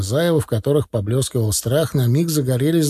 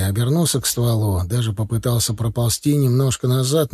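ordinary delivery

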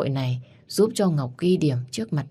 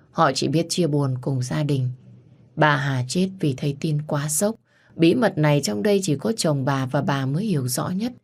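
ordinary delivery

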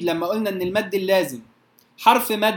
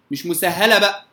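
ordinary delivery

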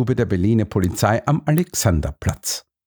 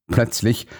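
ordinary delivery

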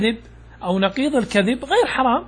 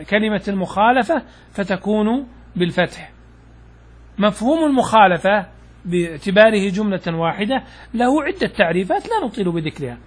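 ordinary talk

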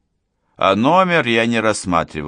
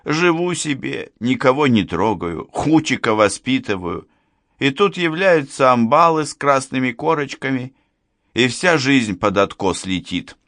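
higher-pitched plaintive complaint; lamenting; 7 semitones higher than usual. A narrator's reading. Man complaining that detectives ruined his life, lamenting. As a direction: bewail your fate, phrase by phrase. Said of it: "запричитал мужчина".

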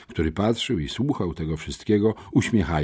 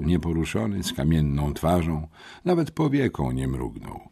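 usual speaking voice

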